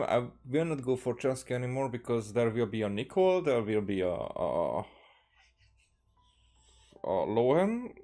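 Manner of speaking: ordinary talk, nothing marked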